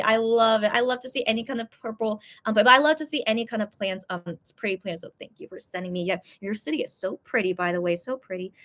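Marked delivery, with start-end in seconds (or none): none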